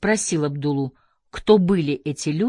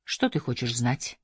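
low, soft and gentle; soft question; 5 semitones lower, 6 dB quieter than usual. A narrator's reading. Kader gently asks the narrator what he wants to know, softly. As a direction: ask it gently, voice lowered. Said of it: "мягко спросил Кадер".

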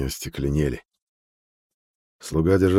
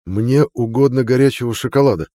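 loud; 6 semitones higher, 6 dB louder than usual. A narrator's reading. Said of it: "наконец громко сказал он".

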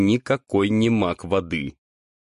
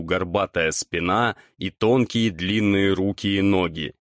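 usual speaking voice

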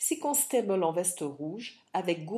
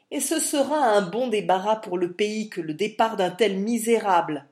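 scornful parting shout; 3 semitones higher, 9 dB louder than usual. Acted. Milord insults while retreating.

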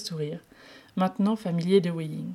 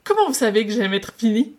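wide-eyed astonishment; 4 semitones higher, 9 dB louder than usual. Acted. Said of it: "les yeux écarquillés d'étonnement".

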